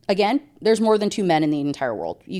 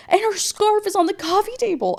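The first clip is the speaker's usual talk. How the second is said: much higher squeaky tattle; mock high tattling; 14 semitones higher than usual. Girlfriend tells the officer about the scarf, squeaky.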